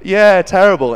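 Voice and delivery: loud, high fake agreement; feigned dismay. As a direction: play along with mock sympathy. Feigned agreement, secretly delighted.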